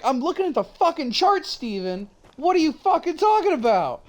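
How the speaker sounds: high-pitched, exasperated snap at Steven; exasperated retort. Retold or imitated. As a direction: fire it back at him, fed up. Retold own argument with Steven.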